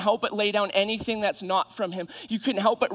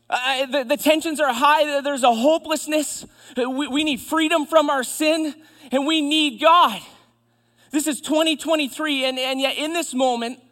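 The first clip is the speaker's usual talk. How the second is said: raised, higher preacher's voice; impassioned preaching; 7 semitones higher, 6 dB louder than usual. Real, not acted. Preacher tells church this is twenty twenty-three, impassioned. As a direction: drive it home with urgency.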